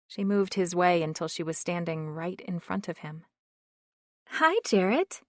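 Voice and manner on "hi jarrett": light, airy hello, pitched high; feathery voice. A narrator's reading. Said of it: "she said in a feathery voice".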